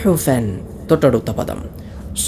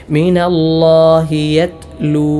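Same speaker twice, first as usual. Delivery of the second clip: slow melodic chanting of the verse; Quran recitation; 5 semitones higher, 4 dB louder, much slower than usual. Shown as a demonstration. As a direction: draw each word out in recitation. (സാവധാനം ഈണത്തിൽ പാരായണം)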